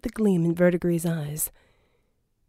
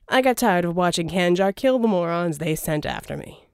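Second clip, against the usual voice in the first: louder, smooth persuasive schemer; a charming villain's pitch, louder; Verdigris voiced; 4 dB louder than usual. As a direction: sell the deal, oily and grand. Verdigris explaining reasons.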